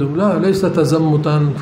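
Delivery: normal talking voice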